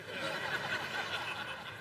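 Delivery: soft laugh. Real, not acted. Preacher laughs at own joke.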